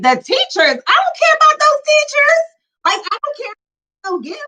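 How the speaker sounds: high-pitched exasperated outburst; retold blow-up. Daughter snapping at her mom over teacher gifts, exasperated shriek. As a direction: shoot up into an indignant squeal.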